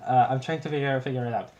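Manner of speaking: ordinary delivery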